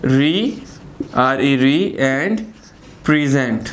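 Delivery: री spelt out, then प्रेजेंट apart; two-part split. Shown as a demonstration. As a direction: pause between री and प्रेजेंट. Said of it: "यानी कि टू syllable".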